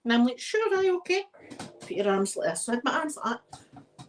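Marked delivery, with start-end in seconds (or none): 0.4-1.2 high, squeaky nervous yes; nervous agreement. Retold or imitated. Nervous agreement before frisk.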